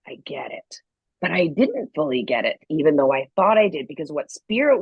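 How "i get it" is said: low, soft dawning understanding; quiet realization. Retold or imitated. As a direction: drop right down as it sinks in.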